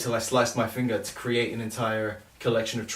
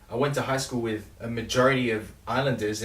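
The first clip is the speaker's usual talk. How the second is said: ordinary delivery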